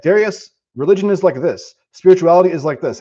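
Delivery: high, loud eager explaining; eager insistence. Retold or imitated. Acted visitor telling him.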